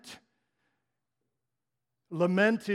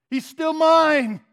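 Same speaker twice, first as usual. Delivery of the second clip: much higher, much louder, slow; triumphant declaration; 7 semitones higher, 9 dB louder, slower than usual. Real, not acted. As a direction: proclaim it with joyful certainty. Climax of sermon.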